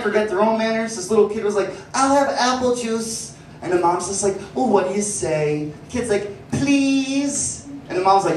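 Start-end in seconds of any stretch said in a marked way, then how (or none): 1.9-3.3 put-on higher, slow small-child voice; lightly voiced child asking for juice; little kid. 6.5-7.7 put-on higher, small-child please; a kid dutifully saying please; lightly voiced child; little kid. Kid prompted for manners.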